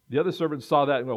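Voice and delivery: usual speaking voice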